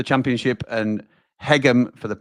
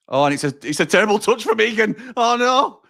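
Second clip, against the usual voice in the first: rising alarm; 12 semitones higher, 4 dB louder than usual. Real, not acted. Fan seeing Egan's terrible touch, rising alarm. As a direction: pitch climbing high into a loud wail.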